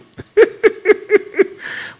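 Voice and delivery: high-pitched burst of laughter; delighted high laugh. Real, not acted. Preacher laughs at doubt.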